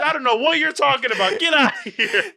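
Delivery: high, loud indignant rant; mock outrage. Contestant disputing the word college, mock outrage. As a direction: protest loudly in playful fury.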